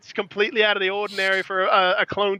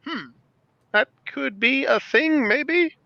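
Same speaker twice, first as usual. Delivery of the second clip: slow, rising, doubtful hmm; doubtful musing; 7 semitones higher, much slower than usual. Retold or imitated. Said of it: "And I'm like".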